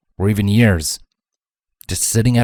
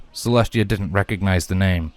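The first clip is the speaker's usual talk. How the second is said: ordinary delivery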